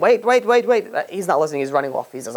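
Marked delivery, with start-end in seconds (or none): 0.0-0.9 higher, mildly raised mock yell; mock screaming. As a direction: imitate someone yelling after a man leaving. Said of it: "She's, like, screaming at him".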